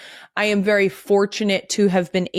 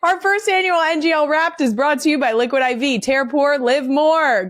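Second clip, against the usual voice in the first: higher, upbeat sponsor read, quick; 7 semitones higher than usual. Acted. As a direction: sell the sponsor like a commercial.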